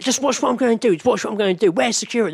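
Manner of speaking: high, loud mocking woman's voice; imitating a shoplifter showing off; brazen shoplifter woman. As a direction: taunt the shop staff, high and loud. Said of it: "she was like".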